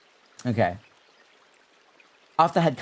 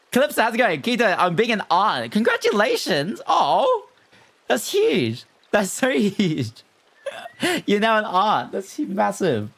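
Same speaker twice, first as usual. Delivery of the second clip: much higher, squealing with laughter; excited congratulations; 11 semitones higher than usual. Real, not acted. Streamer congratulates a chatter on becoming an aunt, squealing higher. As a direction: gush over the big news. Greeting chat, news of new aunt.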